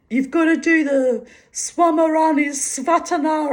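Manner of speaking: put-on loud, high German-accented instructor; mock German accent. Woman mimicking her yoga instructor, mock German accent. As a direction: imitate a German yoga teacher, pitched high. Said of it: "he said something to me in his accent".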